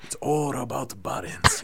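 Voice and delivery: slow, mock-wise martial arts master; hammed-up sensei dispensing wisdom; corny movie sensei. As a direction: intone it like a nineties film sensei. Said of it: "corny martial arts sensei".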